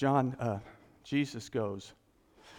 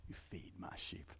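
softer, breathy whisper; whispered charge; 11 semitones lower, 20 dB quieter than usual. Retold or imitated. Jesus quietly charges Peter, in a whisper. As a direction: hush it almost to nothing.

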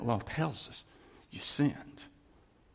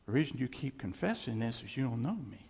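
normal talking voice

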